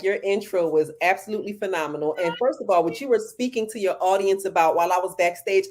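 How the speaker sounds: ordinary talk, nothing marked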